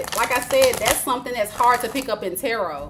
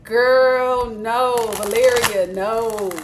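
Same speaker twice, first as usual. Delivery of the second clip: emphatic no; 5 dB louder, much slower than usual. Real, not acted. Telling the client to drop him.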